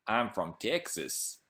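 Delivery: badly faked Texan, vowels stretched out; bad Texan accent. Streamer testing a line on viewers in a bad Texan accent. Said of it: "What should I say in a Texan accent".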